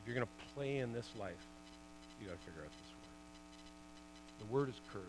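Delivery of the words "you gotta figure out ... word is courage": intense hush. Real, not acted. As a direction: lean in and hush it. Sermon climax.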